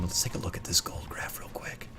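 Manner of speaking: whispered aside; quiet at night. Real, not acted. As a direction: whisper it quickly to the viewers. Aside before showing gold graph.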